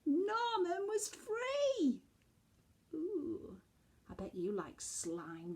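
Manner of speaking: usual speaking voice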